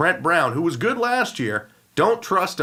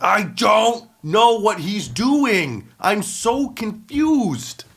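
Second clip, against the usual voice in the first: slow, higher, louder wail of confusion; baffled and fed up, drawn out; exasperated rant; 5 semitones higher, 3 dB louder, much slower than usual. Real, not acted. Ranting podcaster baffled by the Raiders, slow exasperated wail. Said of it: "what the hell is John Gruden doing".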